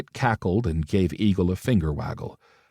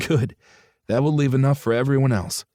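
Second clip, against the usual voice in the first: higher, cutting sneer; 5 semitones higher than usual. A narrator's reading. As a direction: sneer to hide the hurt. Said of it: "He sneered".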